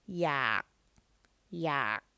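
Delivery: yak on a low falling tone; low tone demo. Shown as a demonstration. Teacher modelling low tone yak for learners. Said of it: "So that is a low tone".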